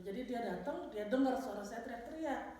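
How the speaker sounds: ordinary delivery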